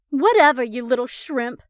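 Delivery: loud scornful brush-off; dismissive gripe. A narrator's reading. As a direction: dismiss him with contempt. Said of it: "Becca gripes".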